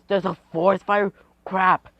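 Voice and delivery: loud, high burst of alarm; high, loud disbelief; alarmed exclamation. Acted. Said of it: "And he says".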